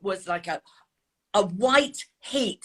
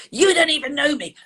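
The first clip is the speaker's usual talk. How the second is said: higher, louder defensive protest; indignant retort; 7 semitones higher, 5 dB louder than usual. Retold or imitated. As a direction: fire back in retold panic. Retold panicked retort to the officer.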